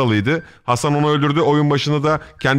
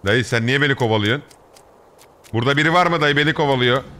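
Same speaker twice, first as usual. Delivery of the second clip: normal talking voice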